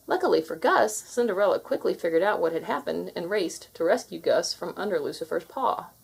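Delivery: ordinary delivery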